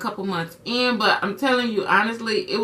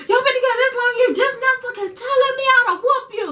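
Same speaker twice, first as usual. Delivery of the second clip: much higher, indignant mock outburst; outraged mom; 14 semitones higher, faster than usual. Mom scolding daughter for hiding relationship, high outraged. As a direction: imitate mom blowing up.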